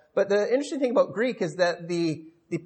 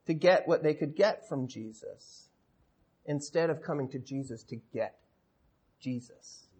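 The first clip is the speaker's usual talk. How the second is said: growing softer and lower to a near-hush on "Jesus"; hushed emphasis; 5 semitones lower, 8 dB quieter than usual. Real, not acted. Preacher contrasting what the crowd came for, hushed emphasis. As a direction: let it sink away, pausing before "Jesus".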